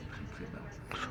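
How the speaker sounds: sinking into a whisper; very quiet. Shown as a demonstration. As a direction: show how softly you can talk, ending voiceless. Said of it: "Moi, par exemple, je peux parler".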